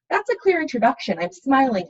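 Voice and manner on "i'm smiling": ordinary talk, nothing marked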